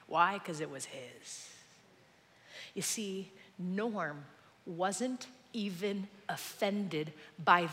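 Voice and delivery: low, soft, reflective sermon voice; hushed preaching. Real, not acted. Preacher confessing his need for control, softly. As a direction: bring it right down and confide in them.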